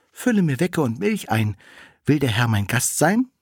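high-pitched, hospitable cat; high lilting cat voice; maid cat. A narrator's reading.

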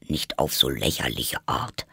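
low-pitched creature grumble; deep Dormouse voice; grumpy Dormouse. Acted. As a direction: grumble deep down as a disgruntled animal. Narrator plays Dormouse.

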